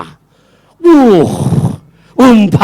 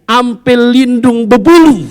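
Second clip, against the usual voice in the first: a higher, louder, slowed Betawi threat; Betawi speech; 7 semitones higher, 4 dB louder than usual. Retold or imitated. Annoyed Betawi man warning someone off, hammed-up Betawi threat. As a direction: throw it out like an annoyed Betawi local. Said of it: "Orang Betawi udah semangetan kesel sama orang ngomong".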